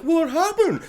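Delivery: high, mock-innocent "What happened?"; Tom's innocent question. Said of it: "Tom comes in being like".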